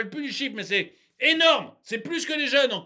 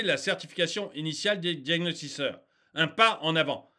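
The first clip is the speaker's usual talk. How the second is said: report read-out; 4 semitones lower than usual. Real, not acted. Ranter reads the report to viewers, composed reading.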